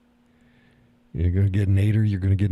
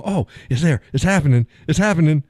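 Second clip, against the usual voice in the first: higher, louder storm-chaser thrill; thrilled anticipation; 8 semitones higher, 4 dB louder than usual. Retold or imitated. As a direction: cheer on the forming tornado. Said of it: "You go".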